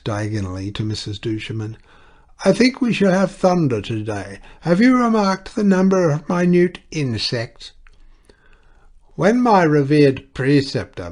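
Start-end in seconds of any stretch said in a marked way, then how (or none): 2.4-7.7 put-on deaf old lady. A narrator's reading. Deaf Miss Fox talking. 9.1-11.1 loud, high declaiming; thundering. A narrator's reading. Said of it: "thundered on".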